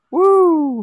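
high whoop of celebration; excited cheer. Real, not acted. Cheering for new Twitter.

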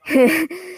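short high giggle; quick high-pitched laugh. Real, not acted. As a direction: let out a real giggle. Laughs while retelling.

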